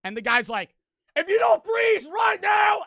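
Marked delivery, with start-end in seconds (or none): 1.2-2.8 very high, loud, slow yell; furious shout. Retold or imitated. Homeowner warning the walkers, furious shout. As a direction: holler it as a final warning. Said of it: "screaming at this guy".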